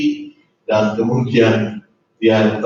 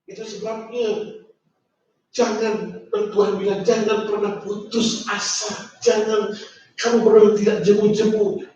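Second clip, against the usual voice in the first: tender exhortation; 11 semitones higher than usual. Acted. Preacher gently urging the congregation never to give up. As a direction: urge them gently not to give up. Softer high pleading exhortation.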